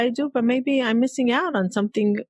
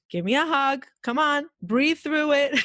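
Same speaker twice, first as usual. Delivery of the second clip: higher-pitched, playful coaxing; coaxing husband; 6 semitones higher than usual. Retold or imitated. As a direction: imitate a husband gently insisting on a hug. Voices husband coaxing a hug.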